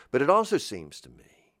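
normal talking voice

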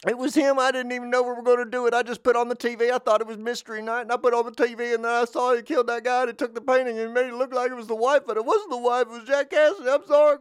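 high, fast, frantic babbling; panicked confession. Pretend. Viewer confessing to Columbo, high, fast and panicked. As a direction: blurt it all out, terrified of being caught. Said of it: "I'd say".

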